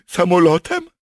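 high, loud incredulous question; amazed guess. Acted. Captured soldier guesses at the escape method, amazed. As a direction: ask it loudly in astonishment. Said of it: "zdumiał się Klaus".

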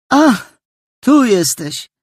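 a touch of surprise that isn't really pleased; Polish: zaskoczone zawołanie; surprised call. A narrator's reading. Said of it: "zawołała zaskoczona".

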